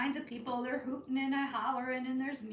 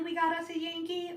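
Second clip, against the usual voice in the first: higher, welcoming Cajun call; friendly version; 5 semitones higher than usual. Retold or imitated. Weighing whether locals welcome her, friendly take. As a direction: greet the newcomer with a friendly lift. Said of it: "this was a friendly".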